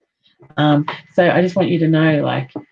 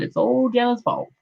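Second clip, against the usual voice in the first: normal talking voice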